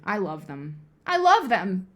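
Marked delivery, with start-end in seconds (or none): 1.1-1.9 loud, higher burst of devotion; punched-up insistent repeat; emphatic declaration. Real, not acted. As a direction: declare it loudly, with conviction. Emphatic repeat of the same words.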